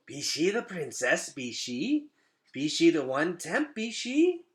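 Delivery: lightly voiced curious roach asking. Roaches greet the princess.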